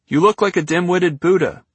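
high, loud mocking shout; shouted across a lot. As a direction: call it out across the field. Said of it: "he shouted across the lot".

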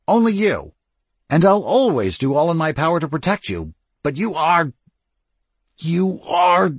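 rising, loud and impassioned; heated pleading. Acted. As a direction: plead hard, voice climbing. Cyril pleads emotionally with Lestat.

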